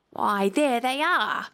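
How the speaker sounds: slow, mildly triumphant announcement. A narrator's reading. King showing the court the tarts, triumphant. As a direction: point it out with measured triumph. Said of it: "said the king triumphantly".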